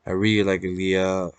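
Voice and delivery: ordinary talk, nothing marked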